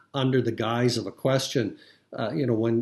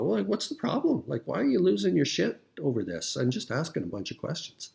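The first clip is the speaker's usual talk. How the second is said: much higher, mock-innocent shrug; mock Tucker, innocent; 7 semitones higher than usual. Tucker feigns innocence to the upset guest, much higher. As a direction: play it dumb and feign surprise at the upset. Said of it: "Tucker can stand back and go".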